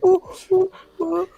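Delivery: high, far off ape hoots; gorilla noises. Pretend. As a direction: hoot like a gorilla. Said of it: "Donkey Kong, the gorilla".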